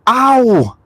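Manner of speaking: squeaky high yelp of pain; high-pitched ow. Retold or imitated. Burning his fingers on the cooker, high squeaky ow. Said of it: "I said it with a high-pitched voice".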